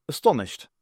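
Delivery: ordinary delivery, nothing marked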